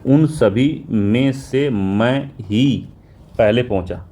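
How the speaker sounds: slow model reading. Shown as a demonstration. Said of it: "slow model sentence".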